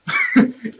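high, giggly burst of laughter; amused laugh. Real, not acted. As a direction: laugh at your own blunder. Laughs at own mishap.

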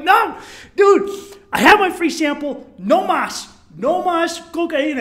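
high, loud acted protest; emphatic shouted refusal. Tourist fighting off hustler, emphatic shouted refusal. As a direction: refuse forcefully, punching each phrase.

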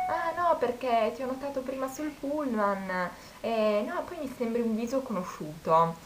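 lightly voiced pushy stranger, up high; imitating the guy. Retelling a guy hitting on her.

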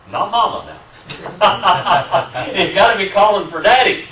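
laughing. Real, not acted. Laughing retelling of parents' conclusion.

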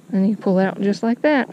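ordinary talk, nothing marked

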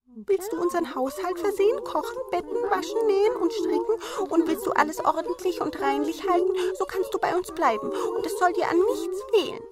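high, brisk dwarf offer; chirpy little dwarves. A narrator's reading. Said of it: "Die Zwerge sprachen".

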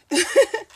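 quick high laugh; light self-conscious giggle; short laugh. Real, not acted. Laughs at herself.

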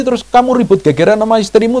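normal talking voice

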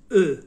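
ا as a rounded front vowel, lips rounded on "e"; Turkish O with dots. Shown as a demonstration.

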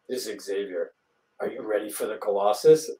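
menacing prank call. Retold or imitated. Prank caller's menacing quote.

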